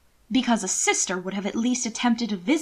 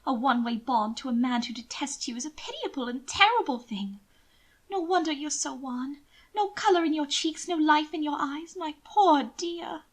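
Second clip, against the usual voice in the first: higher, pitying noblewoman; lightly raised lady's voice, sweetly condescending; Mina's voice; 6 semitones higher than usual. Acted. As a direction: coo over him with false pity. Narrator voices Mina.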